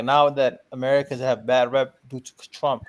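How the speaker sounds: ordinary talk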